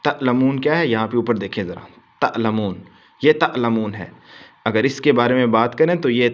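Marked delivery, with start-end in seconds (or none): none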